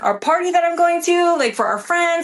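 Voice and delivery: high-pitched, exasperated self-defence; retold pleading. Retold pleading with arresting officers.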